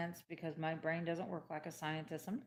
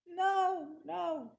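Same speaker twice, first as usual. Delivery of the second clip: much higher, loud disbelieving cry; shocked refusal; 9 semitones higher, 5 dB louder than usual. Retold or imitated. Refusing the doctor's antidepressants, shocked. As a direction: recoil in alarm. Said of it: "And I went".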